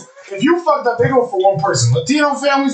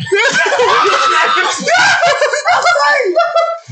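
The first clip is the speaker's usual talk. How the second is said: real howling laugh; shrieking laugh; 13 semitones higher than usual. Real, not acted. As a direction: cackle out of control. Hysterical laugh at fake crying.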